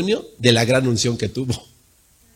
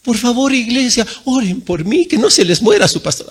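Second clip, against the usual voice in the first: a hammed-up whiny cry for prayers; mock whining plea to the church not to let him die; 7 semitones higher than usual. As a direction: beg the church like a scared pastor, half mocking. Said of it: "Para no estar chillando y de".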